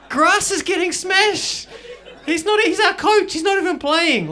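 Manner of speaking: high, loud, hammed-up outrage; outraged yelling. Berating a teammate for playing dead, outraged yelling.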